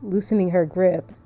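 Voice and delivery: low, measured story-reading voice; story narration. A narrator's reading. Player reading the darling's sharp cry from the story, low narration. Reads story text aloud.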